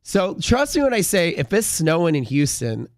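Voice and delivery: ordinary talk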